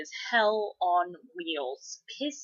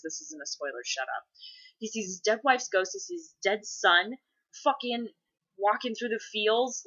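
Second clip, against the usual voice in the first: ordinary talk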